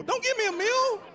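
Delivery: mock outraged shout. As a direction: shriek the refusal in mock outrage.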